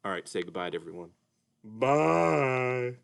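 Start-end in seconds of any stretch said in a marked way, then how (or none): 1.6-2.9 Mister Dolphin puppet. Acted. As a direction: play a sleepy, sing-song puppet. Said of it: "Mister Dolphin".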